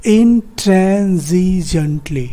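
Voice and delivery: "intransigently" stretched slowly into five syllables, in-tran-zi-junt-lee. Shown as a demonstration. Teacher joins the five syllables for students, "intransigently" slow and segmented. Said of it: "syllable है वो है".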